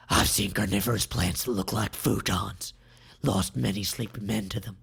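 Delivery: deep, hushed villain voice, half whispered; a low creepy captor sizing up his prey; Melik menacing. Acted. Melik murmurs to captive Sai in a deep creepy voice.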